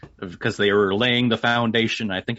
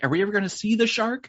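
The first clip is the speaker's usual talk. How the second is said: put-on wondering kid voice; 11 semitones higher, faster than usual. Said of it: "Kinda like how".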